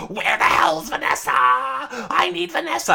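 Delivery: very high, screechy mock rage; high-pitched imitation of a furious demand; Min on the warpath. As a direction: screech for someone like you're hunting them down. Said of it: "Min's on the warpath".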